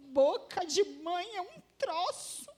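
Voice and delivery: high-pitched imitated crying; hammed-up sobbing complaint; mock tearful wail. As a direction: wail it like a weeping teenage daughter. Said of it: "foi pra dentro do quarto chorando".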